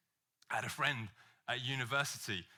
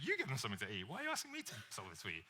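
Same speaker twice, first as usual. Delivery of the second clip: uncharitable Jesus; 4 semitones lower, 8 dB quieter, much faster than usual. Retold or imitated. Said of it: "read it in that vein".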